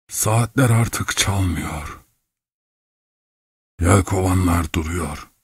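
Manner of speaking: lightly voiced bewildered old man, slow; dazed old man. A narrator's reading. Old clockmaker stumbles into the hut, lightly voiced as dazed. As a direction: let each phrase hang, slightly dazed.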